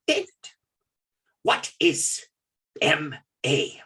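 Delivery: imitated Ugandan accent, halting between words. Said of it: "speaks in his thick Ugandan accent".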